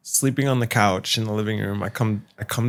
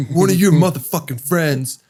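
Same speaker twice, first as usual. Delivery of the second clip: higher-pitched mock outrage; all-caps angry text; 6 semitones higher than usual. Roommate texting about his ruined new shoes, imitated angry rant. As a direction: act out a roommate's all-caps rant without really yelling. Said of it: "it's all capitalized and exclamation points".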